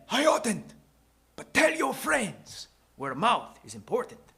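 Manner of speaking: high, put-on old man voice; high-pitched character voice; old shoemaker, declining. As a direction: confide it as a kindly eccentric shopkeeper. Shoemaker refuses payment.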